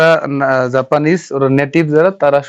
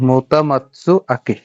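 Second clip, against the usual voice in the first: "মোতামাতসু আকি" drawn out slowly, part by part; slowly sounded out; slower than usual. Shown as a demonstration. Teacher reassembles the spelled name, slowly saying "মোতামাতসু আকি" in parts. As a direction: stretch out "মোতামাতসু আকি" syllable by syllable.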